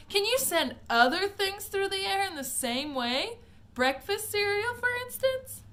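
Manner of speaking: put-on high, loud, pushy kid voice; eager boy. Mike presses Wonka about sending cereal, high-pitched and eager. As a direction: push the question, keen and loud. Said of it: "he shouted".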